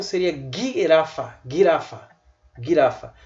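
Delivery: girafa with a hard g as in gato; Hebrew hard g. Shown as a demonstration. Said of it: "se fosse em hebraico". (g duro do hebraico)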